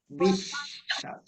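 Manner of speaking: বিশ্বাস said slowly, pitch rising, শ্ব held; drawn-out model word. Shown as a demonstration. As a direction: stretch বিশ্বাস out for the class. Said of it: "এই দেখো". (বিশ্বাস টেনে টেনে উঁচু সুরে বলা)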